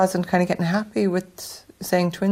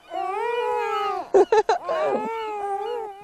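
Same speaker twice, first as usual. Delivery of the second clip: high laugh. Real, not acted. Mother laughs at crying babies.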